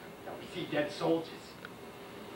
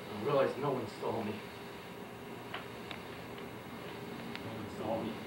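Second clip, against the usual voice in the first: low voice sinking softer; hushed realization; 13 semitones lower, 6 dB quieter than usual. Acted. Soldier realizing his killing went unseen, hushed. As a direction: let it drop away, much lower. Just killed a man, unseen.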